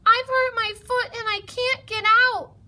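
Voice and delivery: high, loud distress; urgent high-pitched yell; yelling mouse. A narrator's reading. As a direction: call up for help from below. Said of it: "Mouse yelled".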